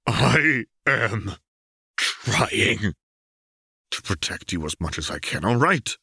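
words bitten off through gritted teeth. Acted. Jace biting out words to Caitlin, strained. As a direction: force each word out, strained. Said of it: "bit out the words".

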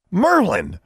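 high, loud shock; appalled one-word outburst; horrified exclamation. A narrator's reading. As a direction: cry out aghast. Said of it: "looking horrified".

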